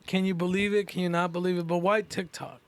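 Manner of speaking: ordinary talk, nothing marked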